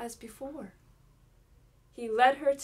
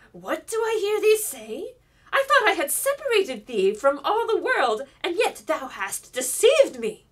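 loud, higher voice of a furious witch; enraged; 6 semitones higher, 11 dB louder than usual. A narrator's reading. Enchantress scolds Rapunzel for deceiving her, loud furious. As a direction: scold with mounting fury.

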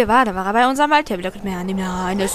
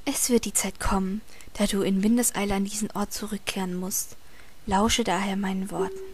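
whispered reading; 3 semitones lower, 7 dB quieter than usual. Acted. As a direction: read it like a secret. Reads game text in whispery voice.

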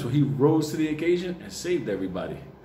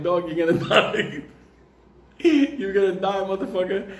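laughing tease; 6 semitones higher than usual. Retold or imitated. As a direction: tease him while cracking up. Teasing friend while laughing.

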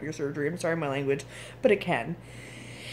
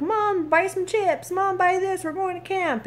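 put-on high, whiny child voice pleading with mom; kids begging; 12 semitones higher than usual. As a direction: beg like a pestering kid, pitched way up. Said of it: "and the kids are like".

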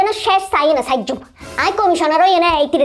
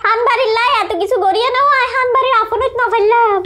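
acted lament; 5 semitones higher than usual. Losing candidate laments to the household that they never accepted her, wailing. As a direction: lament with long, high, stretched line endings.

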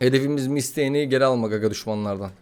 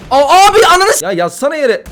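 sudden high angry yell; furious outburst; 11 semitones higher, 8 dB louder than usual. Real, not acted. Shouting in rage at the enemy who won't die. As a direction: blow up at the enemy.

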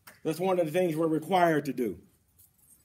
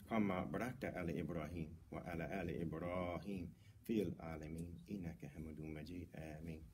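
low, soft Arabic prayer recitation; صلاة هادئة بصوت منخفض; murmured prayer; 9 semitones lower, 12 dB quieter than usual. Acted. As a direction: murmur the blessing devoutly.

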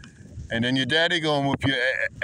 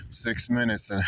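usual speaking voice

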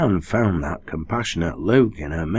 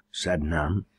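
ordinary delivery, nothing marked

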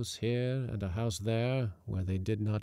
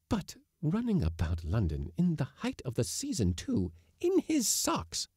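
incredulous; 6 semitones higher than usual. Acted. Wife musing in disbelief.

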